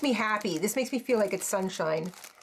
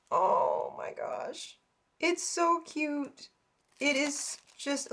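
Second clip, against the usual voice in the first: cooing softly; 4 semitones higher, 4 dB quieter, much slower than usual. Real, not acted. Cooing over a craft piece.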